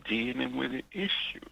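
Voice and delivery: trailing into a soft unvoiced whisper; a whispered, despairing aside; dropping to a whisper. Real, not acted. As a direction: let the words fall away to a breathy hush. Caller pleading with absent official.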